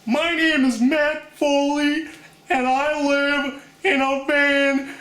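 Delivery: slow, high, strained comic bellow; Chris Farley as Matt Foley. Shown as a demonstration. Introducing himself as a sketch character, hammed-up strained voice. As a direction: stretch each phrase high and forceful. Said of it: "this is Chris Farley from, uh, Saturday Night Live".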